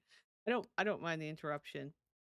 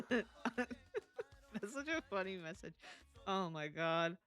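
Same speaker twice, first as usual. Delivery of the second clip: laughing; 2 semitones higher, slower than usual. Real, not acted. Laughing at a chat message.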